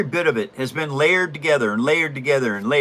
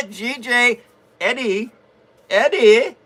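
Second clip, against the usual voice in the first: raised, higher name call; 6 semitones higher, slower than usual. Real, not acted. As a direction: summon someone, lifting the pitch. Calling Eddie up to perform.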